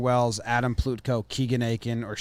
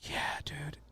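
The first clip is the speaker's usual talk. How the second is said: whisper; 4 semitones higher, 14 dB quieter than usual. Real, not acted. Quiet aside.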